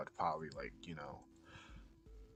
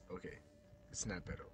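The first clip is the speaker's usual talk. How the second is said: usual speaking voice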